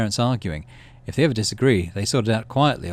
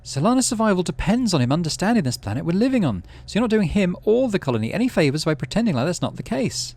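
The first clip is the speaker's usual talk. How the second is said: mum's voice; 9 semitones higher, 4 dB louder than usual. Acted. Mum lecturing dad.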